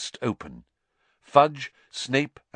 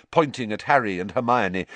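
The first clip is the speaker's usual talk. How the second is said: ordinary delivery, nothing marked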